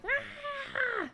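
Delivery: high squealing mock cat cry; cat yowl. Imitating the cat that scratches her, high squeal. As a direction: imitate the cat's screech. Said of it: "He's like".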